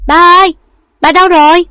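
loud high call to her father; shouting for dad. A narrator's reading. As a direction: call out across the yard, loud. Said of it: "hét to lên". (giọng gọi to, hét lên gọi ba)

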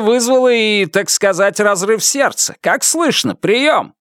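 high, urgent voice through static; over the radio. A narrator's reading. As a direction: push the words through a crackly radio. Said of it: "Голос его был невыразительным и далёким".

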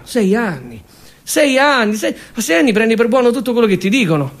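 ordinary talk

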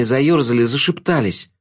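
ordinary delivery

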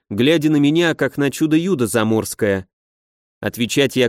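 plain reading voice

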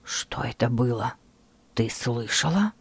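low, hushed whisper; quiet voice. Acted. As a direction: ask it barely above a breath. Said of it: "спросил он тихим голосом".

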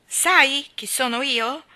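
loud, slow, commanding question; slow and loud, each word an order; imperious demand. A narrator's reading. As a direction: demand it like a young lord. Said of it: "ripeté Colin ancora più imperiosamente".

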